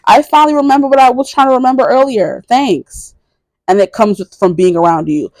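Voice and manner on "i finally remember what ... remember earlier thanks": higher, brighter pretend voice of a friend suddenly remembering; grateful friend. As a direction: act out a friend's happy relief at remembering.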